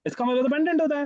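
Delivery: students' imagined aha moment; pretend realisation. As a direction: play the student who suddenly gets it. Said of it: "तो अब हमें समझ आ रहा है".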